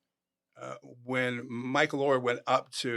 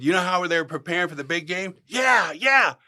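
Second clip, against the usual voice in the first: loud questions and high shouted yeahs; mounting excitement; 7 semitones higher, 10 dB louder than usual. Retold or imitated. Asking the player about his favorite movie, mounting excitement. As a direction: build it to a shouted payoff.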